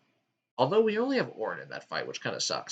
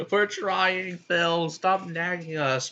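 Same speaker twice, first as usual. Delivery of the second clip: high, drawn-out mock whine; exasperated complaint; 6 semitones higher, much slower than usual. Players telling Phil to stop nagging, mock exasperation. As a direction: complain back at a nag in play.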